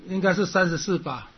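usual speaking voice

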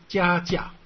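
first 加 held, second 加 cut short; lengthened 加, then a brief stopped one; long then short. Shown as a demonstration. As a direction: contrast a drawn-out 加 with an abrupt 加. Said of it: "它的音呢缩短变成短促音".

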